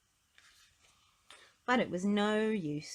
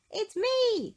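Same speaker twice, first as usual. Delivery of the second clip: much higher, louder call; 8 dB louder than usual. Acted. Mister Happy calling through Miss Giggles' door, high and loud. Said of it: "called out Mister Happy".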